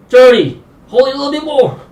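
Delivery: high, strained push through the plank; straining. Real, not acted. Coach holding a plank with viewers, high strained voice. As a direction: squeeze the words out under strain.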